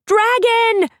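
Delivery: shrill high scream of "Dragon!"; screamed warning. Acted. Teenagers fleeing the dragon, screaming in panic. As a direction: shout it out as you flee in terror. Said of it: "The scream came from a dozen mouths".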